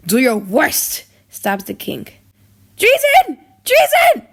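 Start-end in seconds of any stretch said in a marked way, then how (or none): 2.8-4.3 much higher, much louder alarmed cry; shouting treason. Acted. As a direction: scream the alarm to the whole court. Crowd cries as king is stabbed.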